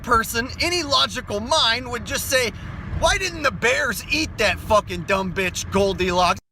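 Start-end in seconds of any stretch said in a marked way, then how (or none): none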